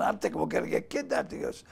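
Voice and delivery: normal talking voice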